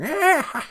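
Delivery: witch cackle. Retold or imitated. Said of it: "Hillary had that cackle".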